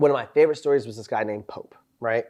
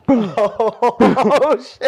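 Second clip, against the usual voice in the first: laughing out, high and loud; bursting out laughing; real laughter; 8 semitones higher, 7 dB louder than usual. Real, not acted. Friend cracking up at the foot locker story, laughing loudly.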